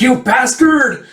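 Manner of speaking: slow, high, loud yell; outraged curse. Retold or imitated. Shepherd curses man who scared sheep, outraged. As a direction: draw the curse out in a high shout. Cursing during stampede.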